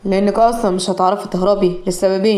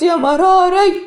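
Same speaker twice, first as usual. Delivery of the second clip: slow, high, loud wail on مراري; crying hard; 9 semitones higher, 3 dB louder, much slower than usual. Acted. Mother wailing for her lost daughter, slow high lament. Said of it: "فاطمة بعياط شديد".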